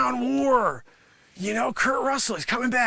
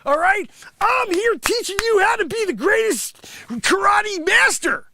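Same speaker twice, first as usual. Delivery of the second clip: ordinary delivery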